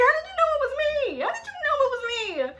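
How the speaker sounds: high, squeaky how did you know; caught-out panic. Retold or imitated. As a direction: yelp like a kid caught red-handed. Caught out by her mom.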